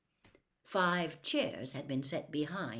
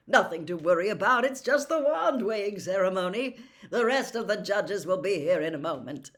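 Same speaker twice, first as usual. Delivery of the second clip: loud, higher jovial booming host; hearty Bagman; 7 semitones higher, 10 dB louder than usual. Acted. Jolly judge welcoming the young champion, loud and booming. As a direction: welcome him with big bluster.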